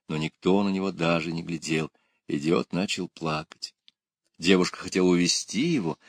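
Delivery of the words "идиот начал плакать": plain reading voice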